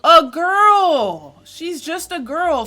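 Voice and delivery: high, very slow, loud plaintive wail; hammed-up sympathy; mock pity. Streamer defending the crying player, mock wail.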